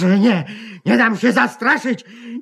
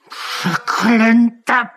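anguished cursing cry; much slower than usual. Acted. Alone after the visit, publisher cries out in despair. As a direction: wail it out in despair. Distraught man alone curses novel.

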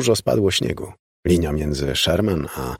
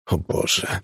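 hushed, breath-only groan; 4 dB quieter than usual. A narrator's reading. Father sees mother running to the figure, groaning. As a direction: groan it under the breath in horror. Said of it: "jęknął ojciec".

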